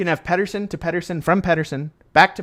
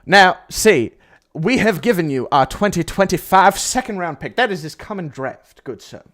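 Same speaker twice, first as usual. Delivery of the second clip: put-on Texas oil baron accent; 4 semitones higher, 5 dB louder than usual. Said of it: "sound like a Texas oil baron".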